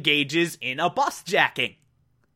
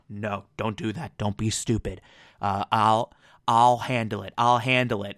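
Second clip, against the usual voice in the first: low FBI agent; 8 semitones lower than usual. Retold or imitated. FBI agent stops them quietly.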